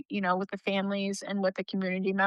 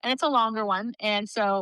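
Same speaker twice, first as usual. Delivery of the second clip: ordinary talk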